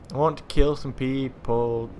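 slow, stretched-out repeat; drawn out. Real, not acted. Player waiting to kill some people, drawn out. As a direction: draw out each word, especially "people".

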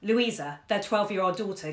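ordinary delivery

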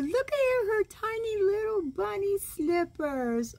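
cooing delight. Real, not acted. Grandma shows grandkids the kangaroo picture, high cooing. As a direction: squeal over the slippers.

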